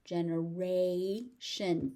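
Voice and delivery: "Generation" slowly as jenuh-raaay, shun; drawn-out pronunciation. Shown as a demonstration. Teacher showing learners "Generation", slow and drawn out. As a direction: stretch the "ray" in "Generation", then "shun".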